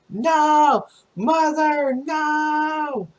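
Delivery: very high, drawn-out wail; long high mock cries, word by word; acted cry of protest. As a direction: imitate a daughter calling out in horror. Retold daughter crying out to mother.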